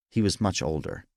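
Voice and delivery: usual speaking voice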